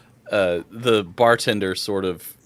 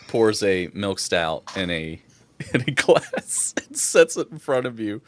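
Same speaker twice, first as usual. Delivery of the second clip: ordinary delivery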